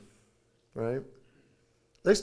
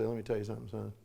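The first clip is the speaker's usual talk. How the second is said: lower, softer, firm; father quietly correcting his son in private; stern father talk; 5 semitones lower, 6 dB quieter than usual. Retold or imitated. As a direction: take the son aside, low and serious. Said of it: "pull him aside and say".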